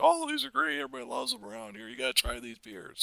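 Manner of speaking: the bartender pushing the local beer, in a put-on high voice; imitating the bartender. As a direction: sell it like a cheery bartender. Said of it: "And the bartender said".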